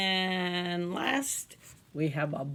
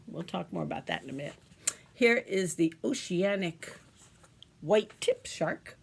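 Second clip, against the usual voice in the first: ordinary delivery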